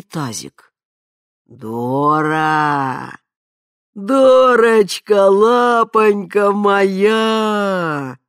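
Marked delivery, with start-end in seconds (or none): 1.5-8.2 put-on slow, loud, tender rumbling old man; tender growl. A narrator's reading. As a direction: growl it lovingly to a pet. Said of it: "ласково прорычал он".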